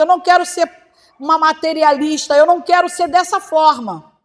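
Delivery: loud, pleading cry to the Lord; fervent prayer. Retold or imitated. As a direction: plead with God at full voice. Prayer quoted in sermon, rising intensity.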